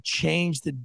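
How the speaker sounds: normal talking voice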